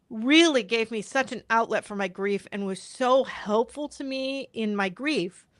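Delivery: ordinary talk, nothing marked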